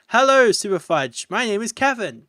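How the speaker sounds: a hint of a chirpy villager; Kevin voice. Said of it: "How you doing, Kevin?".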